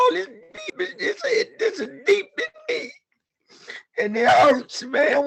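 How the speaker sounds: crying through the words; real tears breaking the voice. Real, not acted. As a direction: voice breaking into tears, high and strained. Testimony about hurt, breaks down.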